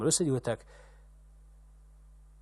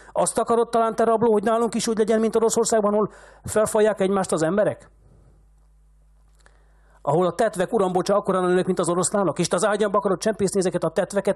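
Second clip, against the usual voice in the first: very high, loud, fast screaming rage; furious shouting; 8 semitones higher, 6 dB louder than usual. A narrator's reading. Old man shaking the shepherd by the collar, screaming. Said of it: "rázni kezdte Manoliuszt".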